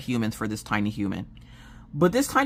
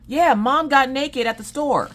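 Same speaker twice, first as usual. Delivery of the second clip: put-on high, loud child's voice yelling out; loud kid yell; 11 semitones higher, 4 dB louder than usual. Ten-year-old announcing it to everyone in the store, high and loud. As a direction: blurt it for the whole store to hear. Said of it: "my ten-year-old yell".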